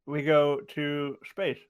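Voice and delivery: talking around a mouthful of ice; words muffled by ice in the mouth. Real, not acted. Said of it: "I have ice in my mouth".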